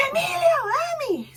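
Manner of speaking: high shouted call across a crowd; calling out loud. Retold or imitated. Fan shouting to a singer in the crowd, loud and high. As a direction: yell his name to get his attention. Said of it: "I'm, I'm loud".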